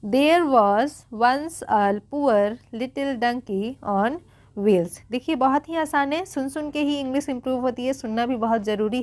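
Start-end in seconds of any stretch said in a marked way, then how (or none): 0.0-5.0 very slow, word by word; slow read-aloud. Shown as a demonstration. Teacher repeating the sentence for beginner readers, slow and measured. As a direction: spell out each word with space around it. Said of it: "चलिए एक बार फिर से repeat करेंगे".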